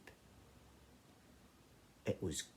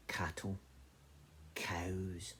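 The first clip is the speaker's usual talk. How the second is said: whispered reveal; 9 dB quieter, much slower than usual. Acted. Goat herd names the creatures.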